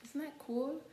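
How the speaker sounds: ordinary delivery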